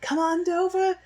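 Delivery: very high, loud cockney shriek, slowing at the end; cockney yell. Retold or imitated. Eliza yelling at her horse in cockney at the races. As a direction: scream at the horse in broad cockney. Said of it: "she's like".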